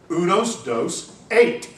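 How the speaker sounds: a tourist spelling out the number in mock Spanish; ugly American tourist. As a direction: tourist tries his bad Spanish on the waiter. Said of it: "you just say it louder and slower".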